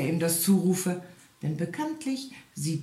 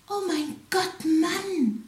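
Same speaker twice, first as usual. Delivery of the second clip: much higher, shocked wife's cry; shrieking wife; 13 semitones higher, slower than usual. A narrator's reading. The wife cries out at her husband's bet: much higher shocked cry. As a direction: gasp it out in alarm, high. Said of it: "schrie dem Igel seine Frau".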